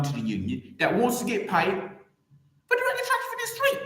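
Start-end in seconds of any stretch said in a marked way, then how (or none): 1.7-3.8 words squeezed out high through a laugh. Real, not acted. Streamer laughing through his own rant. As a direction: crack up while finishing the line.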